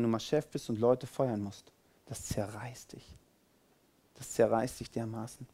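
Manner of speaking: dropped almost to nothing, heavy and soft; hushed intensity. Real, not acted. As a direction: let it sink in quietly. Stressing a painful dilemma.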